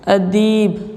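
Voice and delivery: "अदीब" with the ee drawn out; long vowel. Shown as a demonstration. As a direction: dictate "अदीब", lengthening its second syllable. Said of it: "अदीब कैसे होगा?". ("अदीब" की ई लंबी करके)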